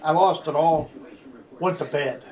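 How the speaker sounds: normal talking voice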